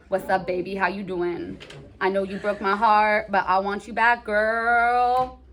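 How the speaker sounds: mock-seductive sweet talk; smooth-talking ex. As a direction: croon it like an ex wanting a second chance. Plays ex calling the candy back.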